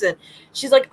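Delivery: ordinary talk, nothing marked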